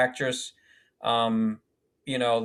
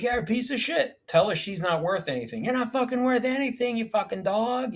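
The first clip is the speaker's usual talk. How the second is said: pitched way up, hurling abuse; replaying cruel scene-partner taunts; mock hostile insults; 11 semitones higher than usual. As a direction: spit the insults high and hard. Acted insults in acting class.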